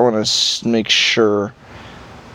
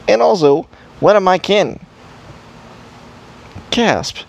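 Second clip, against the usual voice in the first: surprised elf; 7 semitones higher than usual. Acted. Elf character gasping in surprise.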